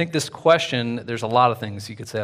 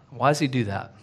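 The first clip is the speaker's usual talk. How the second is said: ordinary delivery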